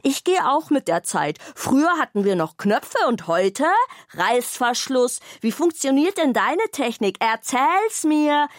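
chattering bag. A narrator's reading. Lonely bag talking Zora's ear off, mildly excited. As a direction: babble on happily without a breath. Bag brags, presses for details.

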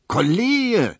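high, loud, drawn-out call; shout. A narrator's reading. Barber to colleague as a letter arrives, shouting. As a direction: yell for your colleague's attention. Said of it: "schrie Tisch".

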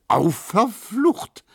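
high, loud, drawn-out cry; called-out exclamation. A narrator's reading. Neumann exclaiming as the customer arrives, calling out. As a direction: stretch it out slowly and high. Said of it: "rief Neumann".

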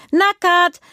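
high, loud gleeful squeal; delighted. Acted. Little girl cheering at her naked belly, gleeful. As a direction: squeal with joy at being naked. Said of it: "freut sich Juna".

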